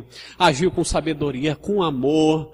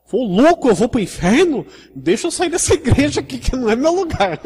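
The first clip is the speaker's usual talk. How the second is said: much higher, panicked startled newcomer; frightened visitor; 10 semitones higher, 5 dB louder than usual. Retold or imitated. A visitor scared off by hellfire talk, high and panicked. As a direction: squeal it like a scared first-time visitor. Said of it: "chegou um visitante aqui".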